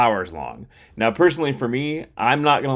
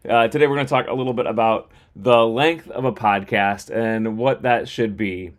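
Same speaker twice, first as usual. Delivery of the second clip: ordinary talk, nothing marked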